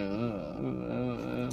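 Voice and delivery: low, slow, wordless mumble; mock an actor's drawn-out grunted lines; mumbling Stallone. Mocking how Stallone's lines all sound, low slow mumbling impression. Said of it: "his lines are always the same".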